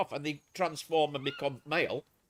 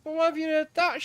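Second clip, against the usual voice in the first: raised, louder retold demand; incredulous challenge; 10 semitones higher, 4 dB louder than usual. Challenging the ex-girlfriend's idea, in incredulous disbelief. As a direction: push back, high and doubting.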